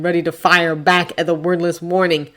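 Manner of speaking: usual speaking voice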